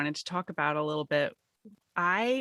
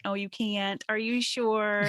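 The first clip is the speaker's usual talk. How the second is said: sneering fake sweet; lightly voiced mean girl, slow doubt; 4 semitones higher, slower than usual. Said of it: "I hear it in the mean girl voice".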